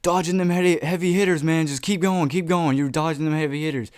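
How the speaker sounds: friend calling out. Retold or imitated. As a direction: call it out like a buddy at a fight. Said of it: "that's when my friend goes".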